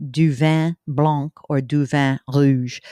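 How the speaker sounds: playful put-on French accent on the wine words; in a teasing French accent; mock French. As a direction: savour the French like a wine snob, slowed. Joking offer of wine.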